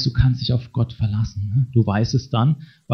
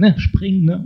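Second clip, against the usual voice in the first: joking dare; 8 semitones higher than usual. Retold or imitated. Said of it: "im Scherz gesagt".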